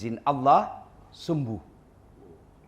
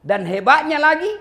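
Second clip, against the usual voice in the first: rising excitement; 13 semitones higher, 10 dB louder than usual. Acted. Nabi climax of lesson.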